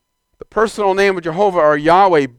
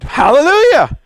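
joyful hallelujah; 9 semitones higher, 7 dB louder than usual. Real, not acted. Preacher exclaims praise.

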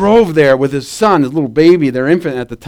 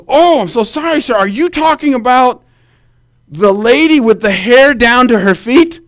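a hint of a surprised officer, higher; lightly voiced officer, raised pitch; 7 semitones higher than usual. Officer answers worried husband, higher and a touch surprised.

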